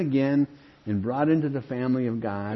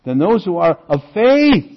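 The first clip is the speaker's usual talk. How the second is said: "faith" called out higher and louder; stress on faith; 6 semitones higher, 11 dB louder than usual. Shown as a demonstration. The preacher stresses "faith" to his church. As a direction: drive the line home on "faith". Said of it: "You see the emphasis here?".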